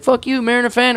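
lightly mock the rival fan; friendly taunt back. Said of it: "he's like".

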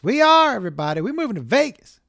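high, eager news; excited announcement. Real, not acted. Comic tells the audience about Vegas, excitedly. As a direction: share it like big news.

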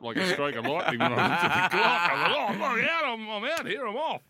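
high, loud, laughing panic at oversleeping; panicked rush. Retold or imitated. Retelling a frantic wake-up.

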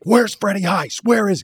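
retold angry demand. After the boat accident, demanding the stunt coordinator, angrily high. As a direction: shout the question up high in mock anger. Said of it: "'cause I'm mad at Freddie".

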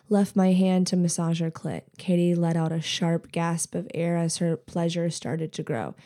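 plain reading voice

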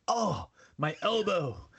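high, drawn-out mock groan of pain. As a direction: act out banging your elbow, slowly. Acts out banging elbow.

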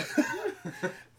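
high, loud laugh; burst of laughter. Real, not acted. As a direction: crack up loudly. Laughs about shades fogging up.

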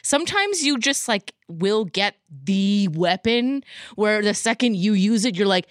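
ordinary talk

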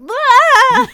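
put-on sudden delighted child's scream; kids' shriek. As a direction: shriek like a wild little kid. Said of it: "these kids are, like".

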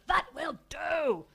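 slow, loud, high roar. A narrator's reading. Father roaring at his daughter to stop, loud. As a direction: roar her into silence. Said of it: "his father roared at her".

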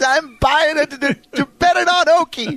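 giggling high through the words; high laughing joke. Real, not acted. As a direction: laugh while you talk it out. Laughing joke about betting.

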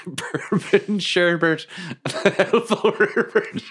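slow, high words squeezed through laughter; cracking up. Real, not acted. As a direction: repeat the silly name while helpless with laughter. Cracking up over the robot's name.

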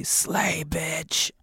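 eerie whispered option. Pretend. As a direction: breathe it out like a ghost. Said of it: "Or D".

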